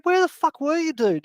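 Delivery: very high-pitched, exasperated questioning; incredulous demand. Retold or imitated. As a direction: confront a friend who vanished, voice shooting up. Confronting friend back after three weeks.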